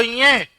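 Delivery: न्य drawn through the nose; nasal sound. Shown as a demonstration. Teacher drills students on the letter, nasal न्य. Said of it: "नाक में बोलो".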